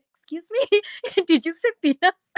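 high, laughing disbelieving question; puzzled native speaker. Retold or imitated. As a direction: mimic a puzzled listener asking back with a laugh. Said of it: "বলল যে". (উঁচু গলায় হাসতে হাসতে অবাক প্রশ্ন)

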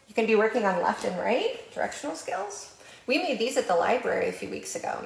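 normal talking voice

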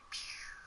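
tiny whispered impact sound; hushed mock impact noise; whispered pew sound. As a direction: breathe out a soft pew. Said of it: "That's the sound of him hitting the ground".

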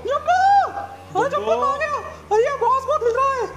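very high squeaky search call; high-pitched hollering for a lost friend; frantic calling. Acted. Searching and calling for missing Jambu.

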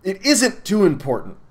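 high, loud, anxious backpedalling; flustered stammer. A narrator's reading. Milo backs off before the angry man, stammering. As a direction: stumble nervously over the first words, then ease down.

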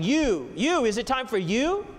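"you" repeated, each one pitched high; stress on you. Shown as a demonstration. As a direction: punch every "you", rising on the last. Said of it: "the Hebrew is emphasizing".

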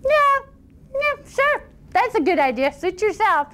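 high, offhand yeah sure; breezy boy. Acted. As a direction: toss off the agreement lightly. Boy agrees casually.

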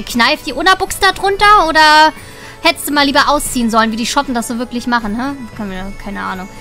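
ordinary delivery

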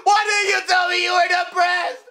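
shouting, crying and laughing at once; hammed-up wailing grief. Dad finds his parrot dead, wailing in overdone grief.

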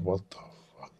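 whispered disbelief; shocked whisper. Retold or imitated. Manager after shots on the stoop, stunned whisper.

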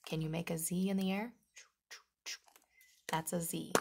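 ordinary talk, nothing marked

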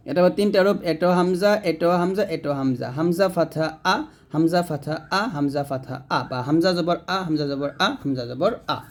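ordinary talk

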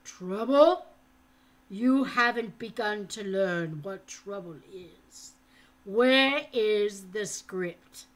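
loud, slow, hollow threat; harsh interrogator voice; menacing captor. A narrator's reading. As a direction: bear down on him with each word. Falconer threatens the boy.

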